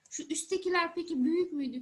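usual speaking voice

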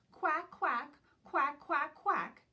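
high, loud duck quacks; loud quacking shout. Acted. Dotty Duck quacking in the park, loud high shout. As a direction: honk them out like a noisy duck. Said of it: "shouts Dotty".

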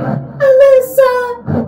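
imitated scream. Imitating the distorted spirit box voice, mock screaming. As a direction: stretch out the name in a mock wail. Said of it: "like, screaming".

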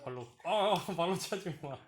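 sharp, loud warning; loud scolding. Real, not acted. Stopping baby kicking rice cooker.

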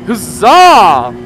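loud, high hammed-up cheer; hammy cheer. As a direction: belt it out like a triumphant knight. Cheering before the ride.

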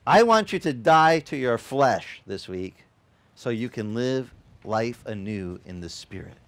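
normal talking voice

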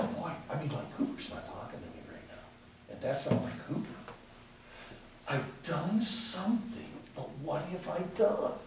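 low, whispered worried self-talk; a soft, low imitation of private worry; worried musing. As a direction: mutter it to yourself in worry. Voicing worried inner thought.

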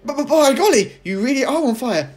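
high, loud stammered alarm; stammering shock. A narrator's reading. George shocked to see smoke from grandma. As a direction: stumble over it in shock.

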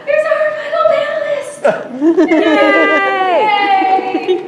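high, loud, laughing welcome; excited cheer. Real, not acted. Host greeting the late panelist, high loud cheer. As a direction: cheer the newcomer in with delight.

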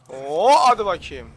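slow, loud drawn-out dare; drawn-out challenge. Real, not acted. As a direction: stretch it out, daring them. Düşmana meydan okuyor. (yavaş ve yüksek sesle meydan okuma)

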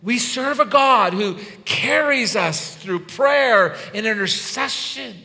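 loud, high, slowed declaration; impassioned preaching. Real, not acted. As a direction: proclaim it from the pulpit, drawing out the key words. Preaches at full force.

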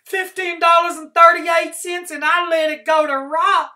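high wailing near-sob; almost sobbing. Acted. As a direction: wail it, close to tears. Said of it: "Trotter was almost sobbing now".

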